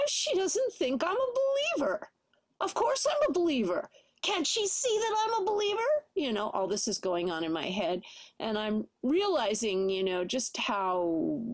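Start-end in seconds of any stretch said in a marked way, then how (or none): 0.1-5.8 high indignant inner voice; mock-offended inner protest, pitched up. She hands me a tract, I protest inside. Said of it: "I just go".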